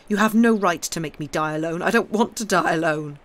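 very loud, desperate shouting; bellowed protest. A narrator's reading. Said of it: "shouting, bellowing".

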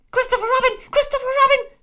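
very high, loud squeaky cry; shouting Piglet. Acted. Piglet shouting to Christopher Robin for help. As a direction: yell for rescue. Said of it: "schrie Ferkel".

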